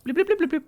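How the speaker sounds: high, loud bloops; imitated blooping. Gamer playing along with the game, high imitated blooping. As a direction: rattle off bubbly bloop noises up high.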